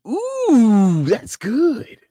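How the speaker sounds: high, loud delighted ooh; mock-swooning ooh of delight; savoring ooh. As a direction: swoon over the sound. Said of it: "And it's just like".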